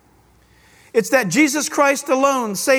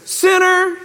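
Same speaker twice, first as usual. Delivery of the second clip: judge yelling; 6 semitones higher than usual. Retold or imitated. Judge shouting at the competitor. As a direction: shout the verdict across the range. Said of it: "He would yell back".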